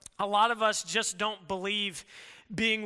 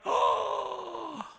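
a higher startled oh; light, higher mock fright; mock alarm; 7 semitones higher than usual. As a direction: gasp it out in mock panic. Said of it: "you're like".

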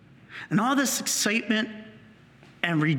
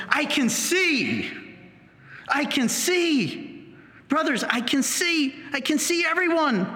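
excited cry; 8 semitones higher than usual. Acted. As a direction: shout it out with wonder. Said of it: "he's excited".

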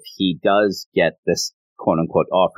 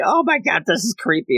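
creeped out; 10 semitones higher than usual. Retold or imitated. Ruru creeped out.

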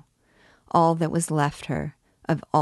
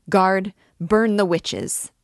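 louder, higher and slower, calling on the guard; furious call; 4 semitones higher, 5 dB louder, slower than usual. A narrator's reading. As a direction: drag out a vengeful demand.

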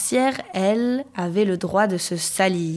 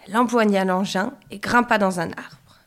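usual speaking voice

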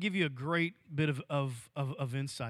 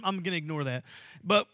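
usual speaking voice

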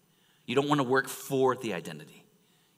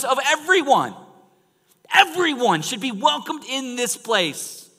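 loud, high-pitched preaching climax; pulpit-pounding sermon build; full-force preaching; 9 semitones higher, 11 dB louder than usual. Acted. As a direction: preach it out to the whole room.

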